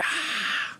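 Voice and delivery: very high fan squeal; imitating a screaming crowd at the show. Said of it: "everyone was screaming".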